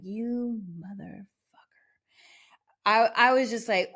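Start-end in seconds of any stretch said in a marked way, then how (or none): 0.0-1.2 hissed whisper under the breath; muttered whisper of disbelief; whispered curse. Retold or imitated. Cursing the departing dog thief.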